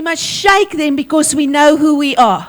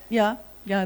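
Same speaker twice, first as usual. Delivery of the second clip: ordinary talk, nothing marked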